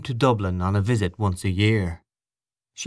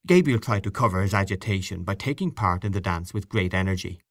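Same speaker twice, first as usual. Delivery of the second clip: plain reading voice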